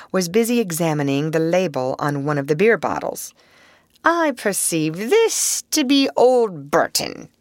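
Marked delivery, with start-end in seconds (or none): 4.0-7.3 slow, high, approving appraisal; measured, higher-pitched approval; approving remark. A narrator's reading. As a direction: savor the discovery with approval. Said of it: "he remarked approvingly".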